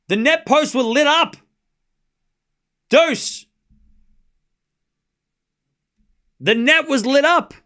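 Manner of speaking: high, loud, incredulous commentary; worked-up disbelief, voice way up and loud; excited, baffled. Real, not acted. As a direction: shout about the strange net lights as if it's unbelievable. Lights on the net baffle her.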